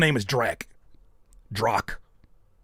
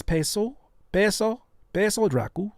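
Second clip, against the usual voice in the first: higher, questioning Spanish-accent attempt; put-on Spanish tonality; 6 semitones higher than usual. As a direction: get into character for the Spanish name. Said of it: "change they whole tonality when they speak in another language".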